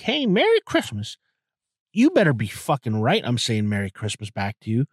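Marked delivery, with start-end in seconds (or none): 0.0-1.2 high, lightly put-on old lady voice; high-voiced old lady greeting. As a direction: pitch it up like a little old lady. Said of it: "some old lady comes to me".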